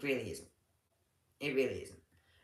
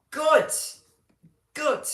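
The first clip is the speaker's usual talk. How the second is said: high, loud delighted "good"; excited praise; 13 semitones higher, 9 dB louder than usual. Real, not acted. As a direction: squeal it with joy. Delighted about Saint Paul's.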